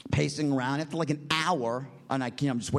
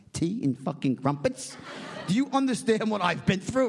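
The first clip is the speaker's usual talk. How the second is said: incredulous echo, building to outburst; mock indignation; 4 semitones higher than usual. Stranded banker to hotel man, indignation rising in pitch.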